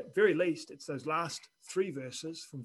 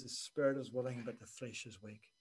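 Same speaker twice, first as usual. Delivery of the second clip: hushed, fading quote; 6 semitones lower, 7 dB quieter than usual. Acted. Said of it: "And he says".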